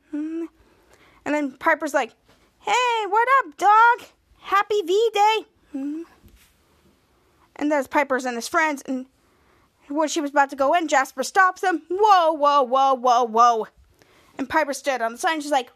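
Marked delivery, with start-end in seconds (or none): 2.6-5.4 high, loud mock-cool hello; hyped greeting. Piper greets Jasper at the club. 11.9-13.7 halting whoas. Retold or imitated. Jasper stops Piper at the door.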